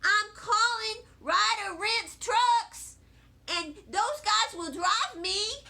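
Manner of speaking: put-on high, loud, emphatic kid retort; defiant child. A narrator's reading. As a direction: announce it stubbornly to Mother. Child argues back at mother.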